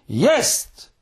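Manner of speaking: high, rising cry of discovery; thrilled high exclamation; excited shout. A narrator's reading. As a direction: cry out high at first sight. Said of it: "krzyknął Muminek".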